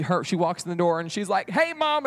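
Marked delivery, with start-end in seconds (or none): 1.5-2.0 high, eager greeting; excited girl. Acted. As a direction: call out to mom, thrilled. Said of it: "She's so excited".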